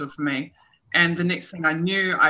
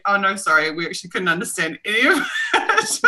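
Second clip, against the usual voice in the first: sorry, laughing, a bit higher; apologetic laugh; 3 semitones higher than usual. Retold or imitated. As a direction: break the news with a laugh. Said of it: "And she said".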